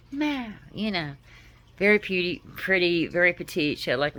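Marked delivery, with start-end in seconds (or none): 0.1-0.5 high, soft cat meow; imitated meow. Owner imitating her cat, soft high meow. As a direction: mew like the little cat. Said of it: "that's how I would say her name".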